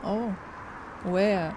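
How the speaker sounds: ordinary talk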